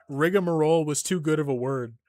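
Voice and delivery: ordinary talk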